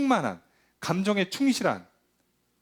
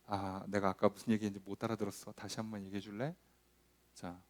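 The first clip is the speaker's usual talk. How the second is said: much softer, gently asking again; gentle asking; 9 semitones lower, 13 dB quieter than usual. Retold or imitated. Speaker calming down and gently asking to hear again, soft. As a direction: ask back softly after calming down. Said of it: "이렇게 하는 게 부드럽게 하는 거거든요".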